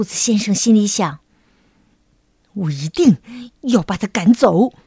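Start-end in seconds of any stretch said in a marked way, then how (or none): 2.5-4.7 low, determined rabbit voice; 暗下决心. A narrator's reading. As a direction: vow it to yourself, low and firm. Rabbit resolves to evict bear. (压低声音，下定决心)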